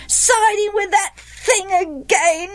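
slow, high singsong taunt; boys chorusing. A narrator's reading. Boys joining mother against Jephthah, chorusing. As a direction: parrot the reproach in children's voices. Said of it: "the other boys chorused".

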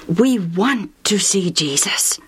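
slow whispered request. Acted. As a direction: ask it in a hush. Asking disciples, hushed.

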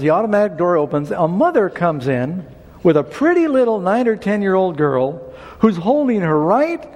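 normal talking voice

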